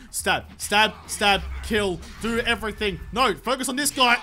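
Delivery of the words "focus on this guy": usual speaking voice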